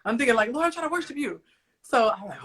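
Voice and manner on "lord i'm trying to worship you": higher-pitched, plaintive mock protest; mild protest. Praying mom to God about being interrupted, mild protest. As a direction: plead mildly to be left alone.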